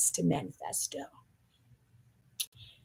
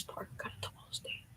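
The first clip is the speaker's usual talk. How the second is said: whisper; 21 dB quieter than usual. Real, not acted. Gossip whisper opening.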